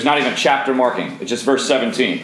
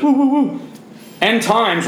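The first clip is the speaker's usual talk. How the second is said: mock excitement; 9 semitones higher than usual. Mocking congregation's excitement.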